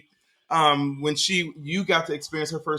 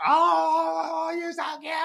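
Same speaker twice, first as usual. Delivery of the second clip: put-on cooing baby talk; 12 semitones higher, slower than usual. Dad coos at his baby daughter before she spits up, high baby talk. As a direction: coo up high like talking to an infant.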